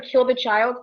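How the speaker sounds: ordinary talk, nothing marked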